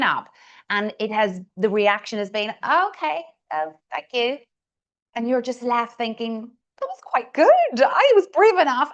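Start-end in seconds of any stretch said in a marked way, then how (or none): 2.6-4.4 hesitant, higher-pitched polite brush-off; unimpressed reaction. Acted. Room responding to someone who spoke up, unimpressed. Acted lukewarm reaction to an idea. 6.8-8.9 high-pitched, mock-indignant inner voice; put-out protest. Speaker left thinking after being brushed off, put-out.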